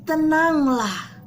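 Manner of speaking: calm the storm with authority; firm but gentle rebuke. Acted. Jesus rebukes the storm, firm then gentle. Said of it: "menghardik perahu itu dengan lembut".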